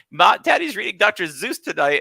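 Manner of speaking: mock the wife by saying it wrong; teasing dad voice. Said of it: "It's like".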